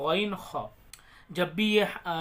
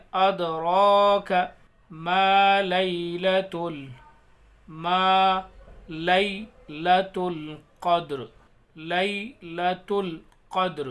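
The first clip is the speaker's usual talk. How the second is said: slow, loud chanted recitation; tajweed recitation, held vowels; Quran recitation; 5 dB louder, much slower than usual. Shown as a demonstration. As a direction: stretch each word melodically. Recitation of verse.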